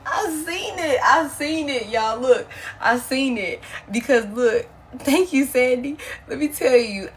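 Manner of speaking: loud, high, thrilled exclaiming; laughing, loud and amazed; real excitement. Real, not acted. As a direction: burst out with delight at the card. Reacting to a card she pulled.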